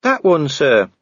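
high, helpful aside from an underling. A narrator's reading. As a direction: point it out helpfully. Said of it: "Cheery added helpfully".

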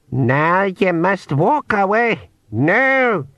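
slow, high Scottish-tinged pixie; old pixie voice. Acted. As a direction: order him slowly, then snap no. Old pixie directs spell.

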